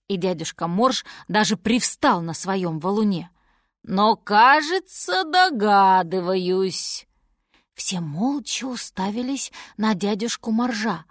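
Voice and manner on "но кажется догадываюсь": very slow, dawning realisation; walrus working it out. Acted. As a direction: stretch each word as the idea forms.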